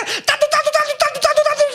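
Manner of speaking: high rapid nagging babble; chattering wife noise. Acted. Husband mimics his nagging wife, high chattering babble.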